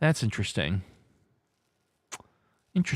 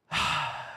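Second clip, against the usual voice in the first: soft, long exhale; real sigh; 4 semitones lower, 6 dB quieter than usual. Real, not acted. Host recovering before the next game, long sigh. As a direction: let the breath out after excitement.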